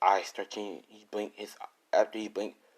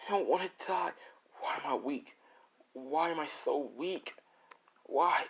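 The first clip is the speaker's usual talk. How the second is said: despairing plea; 7 semitones higher, 3 dB quieter than usual. Acted. Beaten kid asking why he is so weak, despairing. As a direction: beg like you're about to die.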